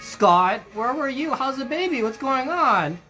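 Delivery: usual speaking voice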